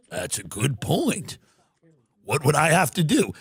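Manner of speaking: a deep-voiced tycoon politely ending a call; Wexner voice.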